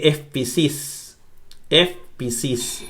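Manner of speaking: espisis drawn out slowly and softly, twice; espisis, slow soft dictation; slow soft reading. Shown as a demonstration. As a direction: read espisis slowly and quietly for the class. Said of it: "ahí dice".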